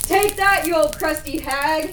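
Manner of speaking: high, loud battle cry; triumphant yell. Acted. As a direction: shout as you shove her in. Gretel pushes witch into oven.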